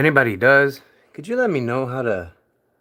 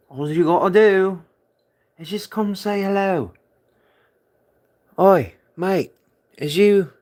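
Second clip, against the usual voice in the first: mock British accent; 3 semitones higher than usual. Coaxing a hiding pet with a mock British accent. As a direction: call out like a cheeky British bloke. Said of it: "Is it the British voice".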